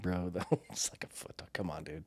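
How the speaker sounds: dismissive mocking. Buddies calling out the raccoon story, soft scoffing. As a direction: deflate the tall tale quietly. Friends mocking his exaggeration.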